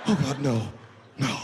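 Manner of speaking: horrified victim's protest; acted dismay; mock dread. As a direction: beg helplessly, played for laughs. Victim dreads the man's demand.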